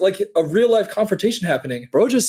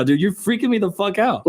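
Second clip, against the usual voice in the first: normal talking voice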